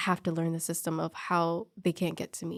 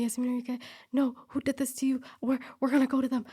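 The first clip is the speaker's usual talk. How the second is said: higher, hurried worried friend; 6 semitones higher, faster than usual. Retold or imitated. Support buddy rushing to defend her, voiced higher and worried. Friend checks on crying nurse.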